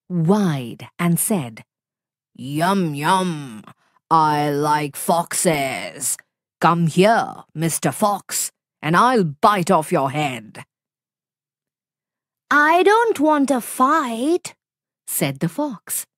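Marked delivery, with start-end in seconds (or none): 2.3-10.6 low, slow, greedy tiger growl; hungry tiger. Acted. Tiger threatens the fox. 12.5-14.5 high, slow, sly fox voice; calm little fox staying cool; clever fox. Acted. Fox answers the tiger.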